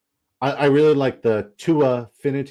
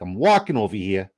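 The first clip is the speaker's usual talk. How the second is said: put-on brash New York street accent. Showing viewers a favorite accent, New York accent. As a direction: call it out like a New Yorker. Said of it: "like, the New Yorker".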